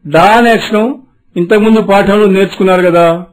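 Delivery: normal talking voice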